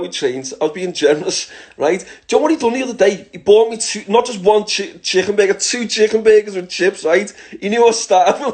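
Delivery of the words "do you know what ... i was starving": high, loud, worked-up storytelling; fired-up, half-laughing complaint; excited rant. Real, not acted. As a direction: rant about him with mock outrage. Excitedly ranting about Ali's burgers.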